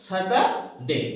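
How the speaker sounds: Saturday said very slowly with no r; silent r, slow. Shown as a demonstration. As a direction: model Saturday slowly, r dropped. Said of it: "R here is silent". (r ছাড়া ধীরে Saturday)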